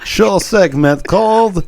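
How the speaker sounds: high, drawn-out hammed-up Russian accent announcer. Host requested to announce segment, hammy Russian accent. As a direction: boom it out like a Russian radio host, slowly. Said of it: "in your Russian accent".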